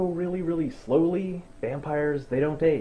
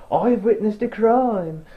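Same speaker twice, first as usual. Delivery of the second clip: higher, formal mock report; mock solemn report; 7 semitones higher, 5 dB louder than usual. Blonde vampire reporting to the Volturi, mock grand. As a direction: announce it grandly. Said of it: "she's like".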